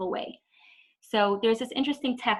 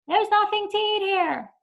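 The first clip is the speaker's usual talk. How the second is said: much higher, drawn-out whine; unpleasant whiny voice; 11 semitones higher, 3 dB louder, slower than usual. Retold or imitated. Kid demanding food from mom, high drawn-out whine. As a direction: sound like a rude demanding kid. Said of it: "I want, like, a pleasant voice".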